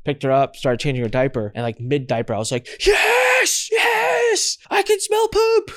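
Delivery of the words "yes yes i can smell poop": high, loud, triumphant yes yes; ecstatic, slowed-down celebration; overjoyed shout. Retold or imitated. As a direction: shout for joy at getting a sense back. Sense of smell comes back.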